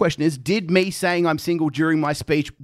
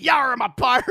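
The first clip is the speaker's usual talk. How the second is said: higher, hammed-up pirate growl; pirate accent; 8 semitones higher than usual. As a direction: ham up a pirate voice at the bar. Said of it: "put accents on".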